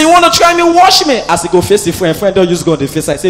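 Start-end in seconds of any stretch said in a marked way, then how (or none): 0.0-1.2 tough guy threat. Retold or imitated. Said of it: "He say".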